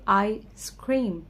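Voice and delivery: "Ice cream" with a gap after "I"; open juncture. Shown as a demonstration. Teacher showing transition time, split into two words. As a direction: pause inside "ice cream", as "I ... scream". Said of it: "अगर मैं ऐसे बोलूँ".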